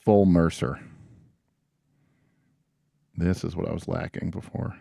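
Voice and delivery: usual speaking voice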